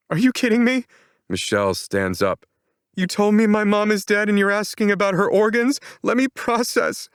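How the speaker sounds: high, loud disbelieving anger; outraged. A narrator's reading. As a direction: snap at him in disbelief. Outraged at organ question.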